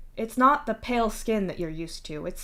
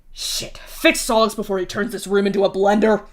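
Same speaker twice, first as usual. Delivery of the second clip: louder, pressing urgent order; urgent hiss; 6 dB louder than usual. Acted. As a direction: hiss it fast at Karkat. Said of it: "you hiss at Karkat".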